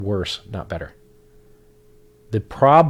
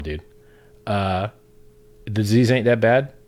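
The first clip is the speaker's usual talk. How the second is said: ordinary talk, nothing marked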